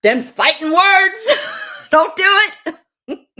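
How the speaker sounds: high, loud, slow comic yell with a laugh; mock fighting-words shout; hammed-up outrage. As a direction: bellow the warning with a laugh. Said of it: "comic warning".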